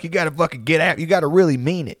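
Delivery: emphatic mock pep talk; lecturing dad. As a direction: urge them on as a worked-up dad. Dad's acted lecture to the kids.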